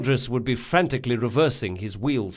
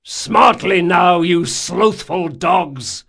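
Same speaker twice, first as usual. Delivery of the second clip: slow, loud, high berating shout; hollered orders; 6 semitones higher, 8 dB louder, much slower than usual. A narrator's reading. First mate to invisible deckhands, hollered. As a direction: drive the lazy crew on with a drawn-out holler. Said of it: "hollered his instructions".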